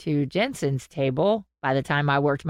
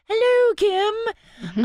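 much higher, slow sing-song hello; greeting Kim; 3 dB louder, much slower than usual. Real, not acted. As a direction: wave at her through the mic. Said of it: "Kim Rhodes listens to the podcast".

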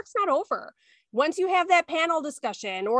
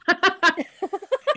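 real laugh; 5 semitones higher, 4 dB louder than usual. Real, not acted. Co-host laughs at own joke.

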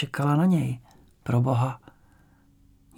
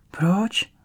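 frightened cry; 6 semitones higher than usual. A narrator's reading. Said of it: "Vykřikne".

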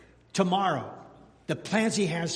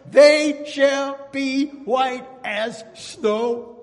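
slow, high, louder sung-out proclamation; proclaiming scripture; 6 semitones higher, 9 dB louder, much slower than usual. Acted. Preacher proclaiming forgiveness to the church, slow ringing call. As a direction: stretch every word like a revival preacher.

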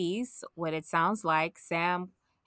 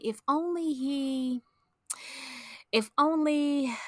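wistful sigh; 7 semitones higher, slower than usual. Retold or imitated. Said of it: "and I was like".

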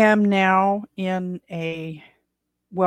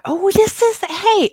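surprised; 10 dB louder, slower than usual. Real, not acted. Snaps back to podcast intro.

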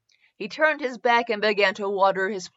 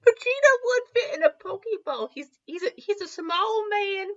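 laughing aside; 11 semitones higher than usual. Real, not acted. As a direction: laugh it out mid-story.

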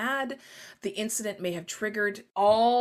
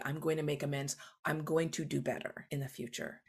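softer, lower earnest promise; sincere promise; 4 semitones lower, 8 dB quieter than usual. Shown as a demonstration. Author demonstrating making amends, soft and low. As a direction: promise to do better, quietly. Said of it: "essential for a good apology".

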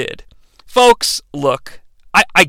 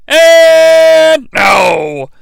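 shocked shout; 8 semitones higher, 5 dB louder than usual. Acted. Shocked call of a move.

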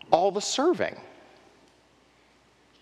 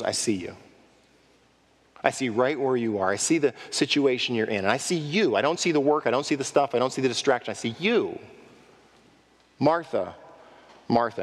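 tender assurance; 6 semitones lower, faster than usual. Acted. Jesus tenderly assuring Martha.

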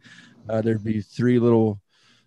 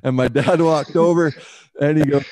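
ordinary talk

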